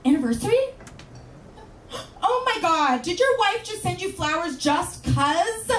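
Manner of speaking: gushing coworkers. Retold or imitated. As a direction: gasp at the flowers like it's big news. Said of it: "they go".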